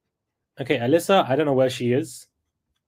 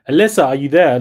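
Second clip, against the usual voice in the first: louder call into the void; calling out loudly; 7 dB louder than usual. Real, not acted. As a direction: call her name, louder.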